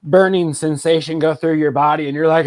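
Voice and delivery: ordinary talk, nothing marked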